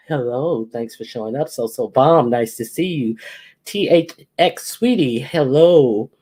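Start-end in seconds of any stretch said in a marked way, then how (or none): none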